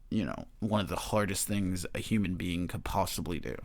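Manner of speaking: ordinary talk, nothing marked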